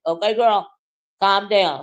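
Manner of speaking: ordinary delivery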